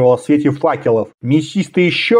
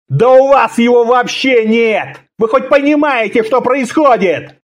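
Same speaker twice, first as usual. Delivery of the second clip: furious outburst; 8 semitones higher than usual. A narrator's reading. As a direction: rail at your captors. Conan's tirade after growling at captors.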